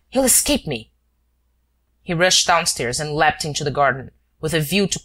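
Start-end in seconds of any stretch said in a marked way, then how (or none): 0.1-0.9 high, urgent cry of frustration; alarmed he'll lose him. Acted. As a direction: Shears fearing the man escapes. Shears sees the man escaping.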